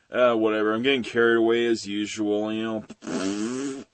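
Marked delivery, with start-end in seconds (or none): none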